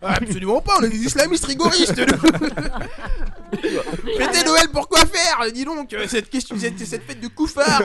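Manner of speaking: deadpan joke that breaks into laughter; sarcastic. Pretend. Sarcastic joke about being rigorist.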